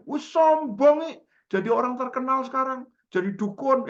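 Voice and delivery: high, loud, teasing caller voice; mock accusing. Caller chides a now famous friend, high mock accusation. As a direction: imitate an offended friend ribbing loudly.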